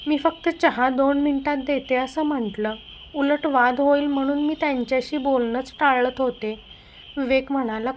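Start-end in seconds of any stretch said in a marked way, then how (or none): none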